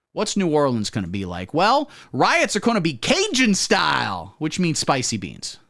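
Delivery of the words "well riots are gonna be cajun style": usual speaking voice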